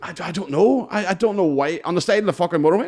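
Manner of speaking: high, loud baffled protest; flustered protest. Retold or imitated. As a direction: stammer it out loud and high, exasperated. Retold protest at being asked weight.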